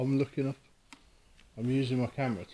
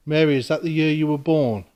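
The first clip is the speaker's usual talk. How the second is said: calling out; 3 semitones higher, 7 dB louder than usual. Real, not acted. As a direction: project your voice into the empty room. Calling out to a spirit.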